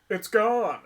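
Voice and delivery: mock shocked discovery. Retold discovering change.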